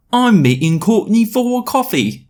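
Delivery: very high hammed-up British voice; put-on British accent. British boy announcing coffee plans, exaggerated British accent. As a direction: overdo a Russell Brand-style British accent. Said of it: "trying to do a Russell Brand accent".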